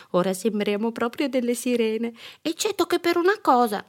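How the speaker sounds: put-on high, delighted child voice; eager child with an idea; excited little girl. A narrator's reading. Girl delighted with mermaid costume.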